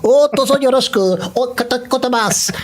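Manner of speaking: high, polite mock Japanese accent. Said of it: "you see this Japanese guy".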